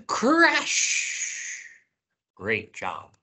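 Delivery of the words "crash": gasp, then a high crash; drawn-out high-pitched crash; big crash shout. Pretend. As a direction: breathe in and call it out for kids. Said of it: "Let's say crash together again".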